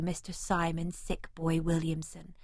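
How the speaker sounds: ordinary delivery, nothing marked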